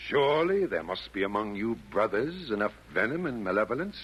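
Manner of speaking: usual speaking voice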